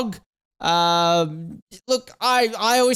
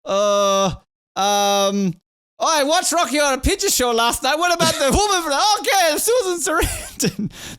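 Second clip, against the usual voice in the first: imagined Italian organisers; 7 semitones higher than usual. Retold or imitated. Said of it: "the Italians are like".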